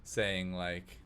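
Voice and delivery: ordinary talk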